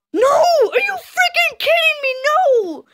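high-pitched wailing shout; shrieked gamer disbelief; outraged disbelief. Real, not acted. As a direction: cry out in high, drawn-out protest. Shouted disbelief at the game's turn.

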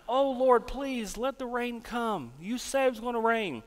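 beg, stretching out the plea; acted-out insistent appeal to the Lord; praying. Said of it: "He prayed a second time".

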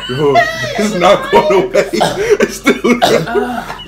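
slow, pained laughing. Real, not acted. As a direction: laugh through the burning. Laughing through hot sauce burn.

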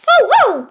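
high, loud playful ow; mock pain yelp. Knocked-over toy yelps loudly in mock pain. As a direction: yelp as if hurt.

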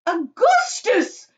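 slow, high, loud call; shouting. A narrator's reading. Mother calling her son, shouting. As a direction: stretch the name into a yell. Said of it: "shouted Missus Gloop".

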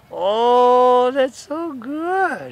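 high, slow, drawn-out cooing; gushing praise. Real, not acted. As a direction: stretch it out to praise a pet. Praising the pet in leash practice.

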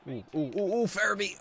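high, loud rising oohs; excited anticipation. Real, not acted. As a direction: build eagerly toward a possible goal. Scoring chance in game.

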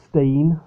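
stein said as steen; alternative pronunciation. Shown as a demonstration. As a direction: say stein with a long ee. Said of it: "however it's pronounced".

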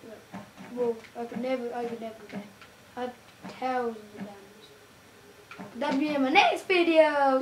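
ordinary talk, nothing marked

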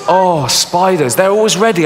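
ordinary talk, nothing marked

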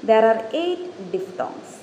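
very slow, deliberate textbook reading; dictating a definition slowly; slow dictation read. Shown as a demonstration. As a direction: read each word out for note-taking. Reads English definition slowly.

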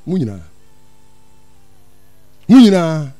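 normal talking voice

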